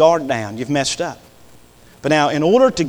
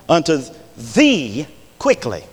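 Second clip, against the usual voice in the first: "thee" drawn out and set apart by pauses; stress on thee; 3 semitones lower, slower than usual. Shown as a demonstration. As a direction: slowly underline the word "thee". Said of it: "I want you to underline that word".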